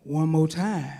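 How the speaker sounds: lightly voiced deep-voiced man. Man repeating his call at rehearsal, lightly deep voiced. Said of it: "I hear the voice again say".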